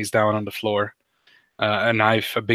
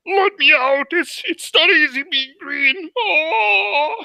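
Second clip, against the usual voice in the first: high, loud crying frog puppet voice; wailing Kermit; 4 dB louder, slower than usual. Acted. Kermit flees the giant bug, wailing high and tearful. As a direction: wail and sob it out as a terrified puppet. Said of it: "like, wailing".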